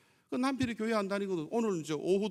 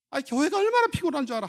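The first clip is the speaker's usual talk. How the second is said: high, loud mock complaint; acted scolding wife; 7 semitones higher, 8 dB louder than usual. Wife back from church berating husband, acted scolding. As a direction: play an irritated wife raising her voice.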